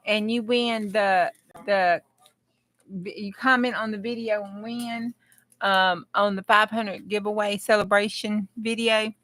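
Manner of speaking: ordinary talk, nothing marked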